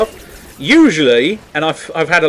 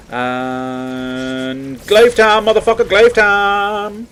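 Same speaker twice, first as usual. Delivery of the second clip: drawn-out playful war cry, rising higher; mock battle cry; 5 semitones higher, much slower than usual. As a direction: stretch it out like a silly catchphrase. Hammed battle cry while playing.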